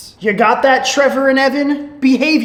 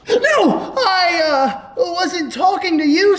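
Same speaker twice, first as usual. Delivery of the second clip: high, stammering denial; higher-pitched caught-out schoolboy; panicked backpedaling; 5 semitones higher than usual. Acted. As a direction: scramble to excuse yourself to teacher. Caught pupil denies insult.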